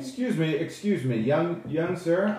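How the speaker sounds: stagey elf cutting off an interrupter; high elf speaker. Acted.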